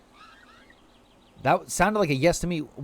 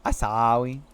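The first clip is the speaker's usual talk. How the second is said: put-on babyish apology; 5 semitones lower than usual. Playful apology to chat, babyish sounds.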